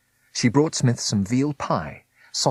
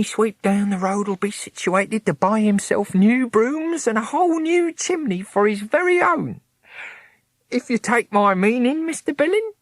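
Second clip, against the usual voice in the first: much higher, louder, knowing; sly Smith; 12 semitones higher, 4 dB louder than usual. A narrator's reading. Smith slyly hints to Mister Billing, much higher. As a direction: wink through every word.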